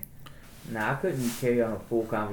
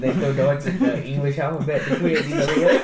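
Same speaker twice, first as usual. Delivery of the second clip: louder, laughing through the question; 4 semitones higher, 9 dB louder than usual. Real, not acted. Joking with friends about random languages, laughing loudly. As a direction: laugh it out in disbelief.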